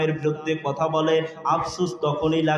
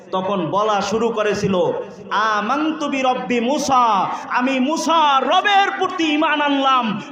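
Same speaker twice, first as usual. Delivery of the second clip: high, impassioned sermon storytelling; full-force preaching; 7 semitones higher, 4 dB louder than usual. Real, not acted. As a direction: drive the story home with raised pitch. Preaches the drowning Pharaoh story. (আবেগভরা উঁচু গলায় ওয়াজ)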